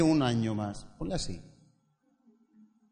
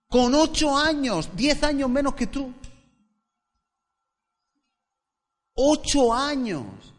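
higher, louder preaching voice, drawn-out on key words; preaching at full force; 9 semitones higher, 5 dB louder, slower than usual. Acted. Pastor preaching to the congregation about young King Josiah, high and loud. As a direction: proclaim it to the church with full force.

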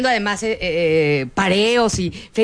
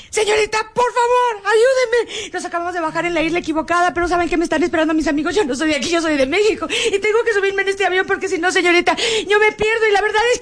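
pleading; 7 semitones higher than usual. Retold or imitated. Tourist begs airline attendant to let her board, tearful high plea. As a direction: beg the attendant like you're about to cry.

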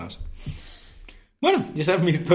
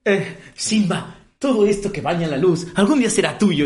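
slow, higher, booming mock Mufasa line; imitating Mufasa actor; 6 semitones higher, 5 dB louder than usual. Retelling the musical to friends, slow grand mock Mufasa. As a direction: ham up the king's grand speech to his son. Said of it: "Habla con este tono".

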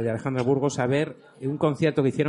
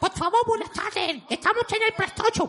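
silly clown. Shown as a demonstration. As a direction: squeak it out like a circus clown. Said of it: "hablar como un payaso".

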